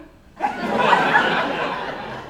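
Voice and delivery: sudden high burst of laughter; real unvoiced laugh; loud laugh. Real, not acted. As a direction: crack up at the story. Laughs at the quote.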